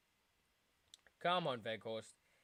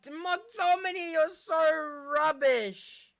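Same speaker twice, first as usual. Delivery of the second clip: high, slow, louder mocking wail; sing-song derisive jeer; drawn-out jeer; 12 semitones higher, 7 dB louder, much slower than usual. Real, not acted. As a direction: stretch out the insult in disgust.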